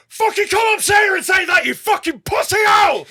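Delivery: furious high yelled heckle; angry shout. Retold or imitated. Heckler screaming at the comedian on stage, furious shout. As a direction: scream it at the comic, raging. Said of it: "he just goes".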